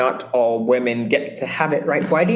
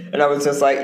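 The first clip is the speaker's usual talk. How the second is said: ordinary delivery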